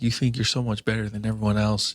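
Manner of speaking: ordinary delivery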